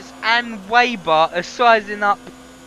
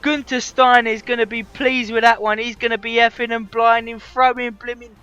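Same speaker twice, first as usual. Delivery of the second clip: yelling at full voice, much louder and higher; screaming the win across the line till the voice cracks; race-win commentary roar, louder and higher; ecstatic victory shout; 7 semitones higher, 9 dB louder than usual. Real, not acted. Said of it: "my voice is gone".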